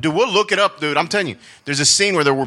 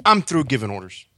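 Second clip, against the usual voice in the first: ordinary talk, nothing marked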